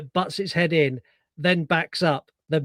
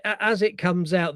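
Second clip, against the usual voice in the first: ordinary talk, nothing marked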